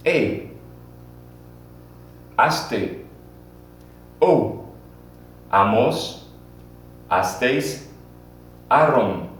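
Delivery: each Spanish ending said slowly and clearly with a pause after it; model endings to repeat. Shown as a demonstration. Teacher models Spanish past endings for students to repeat, clear and deliberate. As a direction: spell out A, aste, O, amos, astéis, aron as a model for the class. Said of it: "repeat after me".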